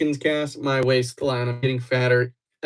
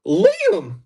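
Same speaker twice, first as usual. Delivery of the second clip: much higher, louder startled yell; yelling Liam; 5 dB louder than usual. Real, not acted. Streamer suddenly yelling at Liam in the chat, startled. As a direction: shout his name across the room.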